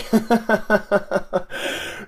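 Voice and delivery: long, even-pulsed chuckle; incredulous rattling laugh. Real, not acted. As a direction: chuckle steadily at the absurdity. Laughs at the short timescale.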